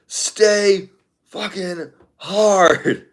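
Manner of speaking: high-pitched, slow, drawn-out motivational yell; David Goggins. Acted. Streamer trying out a suggested impression, high drawn-out motivator's yell. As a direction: stretch each word out into a high, forceful rallying cry. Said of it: "David Goggins is actually a really good suggestion".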